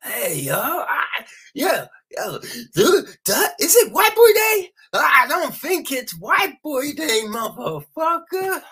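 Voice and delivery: loud, high, menacing street hustler; pimp drug dealer impression. Quoting the movie pimp drug dealer, loud high menacing hustler. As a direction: bark it like a wild pimp. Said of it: "John Malkovich, the fucking pimp drug dealer".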